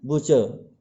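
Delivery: बुचर said "BUH-chuh", without the र; silent final r. Shown as a demonstration. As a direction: drop the r, ending बुचर on a schwa. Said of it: "आर का उच्चारण नहीं किया जाएगा". (बुचर में आख़िरी र मौन रखकर)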